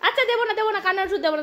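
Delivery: playful mock concession; high playful fine, no medicine; teasing bargain. Real, not acted. Bargaining with the dog.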